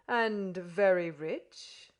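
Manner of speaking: slow, loud and lofty; pompous questioning. A narrator's reading. Podsnap pressing the foreigner, slow and pompous. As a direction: draw it out proudly.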